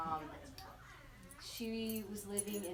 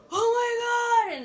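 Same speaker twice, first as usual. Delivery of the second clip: much higher, much louder, slow frightened scream; panicked cry; 15 dB louder, slower than usual. Retold or imitated. Justin shrieking at her near the cliff edge, terrified. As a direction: cry out in sudden terror at the cliff.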